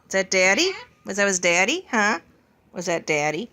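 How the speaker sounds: ordinary talk, nothing marked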